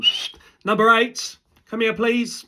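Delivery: high-pitched mock referee. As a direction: summon a player in a put-on ref's voice. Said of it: "That was my ref's voice".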